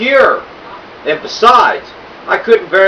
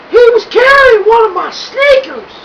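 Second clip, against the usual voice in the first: put-on unperturbed old lady. A narrator's reading. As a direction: answer him sweetly, unbothered.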